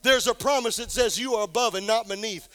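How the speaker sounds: building from firm to a shouted climax on "is a promise"; fiery preacher rising to a shout; preaching at full force. Acted.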